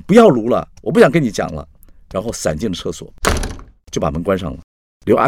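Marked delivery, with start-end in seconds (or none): 0.0-1.7 raised, exasperated refusal; agitated. A narrator's reading. Carrie loses patience with Axi, agitated and higher. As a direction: cut off the argument impatiently. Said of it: "Carrie这时候也急了".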